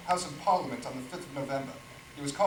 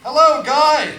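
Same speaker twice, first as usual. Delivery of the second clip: high, loud call across the pub; loud greeting; 11 semitones higher, 17 dB louder, slower than usual. Acted. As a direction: shout hello over the noise. Thomas greets rowdy Guy.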